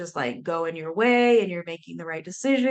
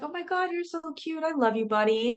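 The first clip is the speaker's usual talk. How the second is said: cooing at the dog; 9 semitones higher than usual. Retold or imitated. Sitting with her golden doodle, cooing baby talk. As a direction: gush over a cute puppy. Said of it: "And I'm like".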